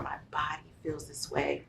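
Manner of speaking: hushed whisper, no voice; whispered aside. Retold or imitated. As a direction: whisper it to yourself. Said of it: "just take them as".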